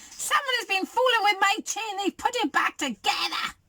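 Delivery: high-pitched little bear tantrum; screeching Baby Bear. A narrator's reading. Baby Bear stomps over his chair, screeching. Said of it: "Baby Bear screeched".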